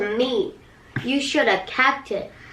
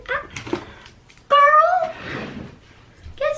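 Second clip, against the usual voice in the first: excited; 3 dB louder, much slower than usual. Real, not acted. Excited at candy find.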